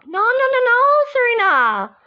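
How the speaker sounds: high, loud cry of refusal; loud protest. Acted. Friend stops Serena giving up, loud protest. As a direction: cut in loudly to stop a friend.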